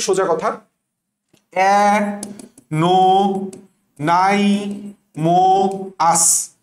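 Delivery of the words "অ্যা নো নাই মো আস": অ্যা, নো, নাই, মো, আস as separate long beats; syllable-by-syllable pronunciation. Shown as a demonstration. Teacher breaking the word up, syllable-by-syllable pronunciation. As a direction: pause after each piece: অ্যা, নো, নাই, মো, আস. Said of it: "সঠিক উচ্চারণ কি হবে?".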